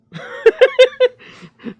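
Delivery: a burst of loud, high laughter. Real, not acted. Laughing loudly at being legally allowed weed. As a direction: crack up loudly at the idea.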